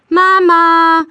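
long, loud high call; drawn-out impatient shout for Mama; insistent calling. A narrator's reading. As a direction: call loudly and hold the word out. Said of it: "ruft Greta wieder".

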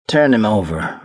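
tense, restrained order; tight voice. A narrator's reading. South ordering the captain to turn the body, tight voice. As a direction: hold it in tightly as you order. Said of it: "South said in a tight voice".

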